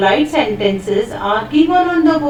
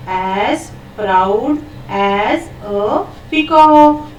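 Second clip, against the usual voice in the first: slow model phrase; slower than usual. Shown as a demonstration. Teacher models phrase for children.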